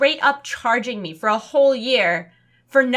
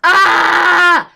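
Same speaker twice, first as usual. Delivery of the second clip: loud high shout of frustration; frustrated yell; 5 semitones higher, 11 dB louder than usual. Real, not acted. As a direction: let out an exasperated yell.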